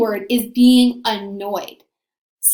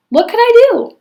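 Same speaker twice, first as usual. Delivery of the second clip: much higher, louder put-upon cry; mock helplessness; 11 semitones higher, 6 dB louder than usual. A narrator's reading. Stepmother pretends she had no choice, put-upon. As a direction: play the innocent martyr. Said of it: "stepmother's mock helplessness".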